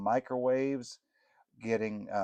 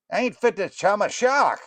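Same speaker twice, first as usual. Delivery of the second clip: much higher, louder fast-talking northeastern jeer; Snickerdoodle voice; 10 semitones higher, 7 dB louder than usual. Acted. Snickerdoodle jeering at the goulash, high northeastern voice. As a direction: sneer it high and loud.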